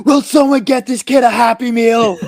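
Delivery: high, loud imitated outburst; losing it. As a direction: yell like a dad at his wits' end. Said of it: "Sandler losing it in Macca's".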